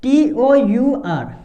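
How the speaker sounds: letter by letter, very slowly; slow spelling. Shown as a demonstration. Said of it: "क्या पढ़ेंगे इसको".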